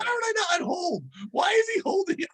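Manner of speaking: high, incredulous mock complaint; exasperated boss. Boss asking why he isn't on hold, high mock exasperation.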